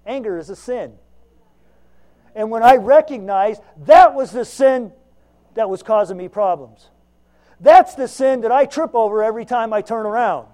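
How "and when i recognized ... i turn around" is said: loud, driving sermon build; fiery preaching. Acted. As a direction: preach it out, full voice. Preaching at full force.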